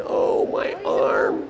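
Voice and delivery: slow, soft acted moan; drawn-out injured lament; pained groan. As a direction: groan weakly as if badly hurt. Beaten man lying on the road.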